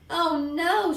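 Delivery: high-pitched horrified cry; mild screamed dismay; acted scream of dismay. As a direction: cry out in alarm, without full volume. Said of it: "Sheila screamed".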